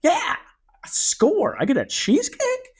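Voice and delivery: high, giddy disbelief; mock giddy customer; overjoyed. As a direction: squeal over free dessert.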